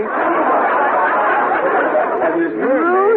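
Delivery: chuckling as he starts a naughty joke, last words jumping high; can barely get the joke out for laughing; laughing through it. Acted. Husband laughing as he starts his mermaid joke for the guests, high and giggly.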